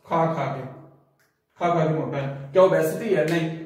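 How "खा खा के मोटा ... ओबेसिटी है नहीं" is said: ordinary talk, nothing marked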